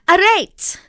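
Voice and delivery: sharp, loud French command; loud stern command in French; commanding shout. A narrator's reading. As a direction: order the child to stop. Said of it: "commanded a loud voice".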